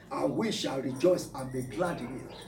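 ordinary delivery

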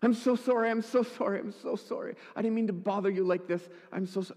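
high, tumbling mock-distraught apology; retold bawling apology. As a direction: imitate a weeping woman who can't stop apologising. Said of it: "Bawling, just bawling".

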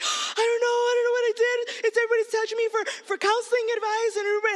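high, innocent, fast excuses; sweet kid voice. Retold or imitated. Little brother pleading innocence to his big sister. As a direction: squeak out a flustered apology like a sweet kid. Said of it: "always been a very sweet kid".

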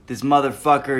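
normal talking voice

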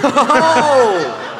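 high burst of laughter; laughing oh. Real, not acted. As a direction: crack up at the pun. Laughing at pun.

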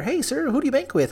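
high, sugary polite greeting; customer-service voice. Retold or imitated. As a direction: sell it like a chirpy bank teller. Retold polite bank greeting.

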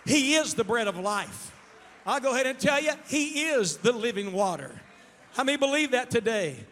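loud, driving declarations; full-force preaching. Real, not acted. Preacher telling the congregation who He is, impassioned loud preaching. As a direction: proclaim it at the top of your voice.

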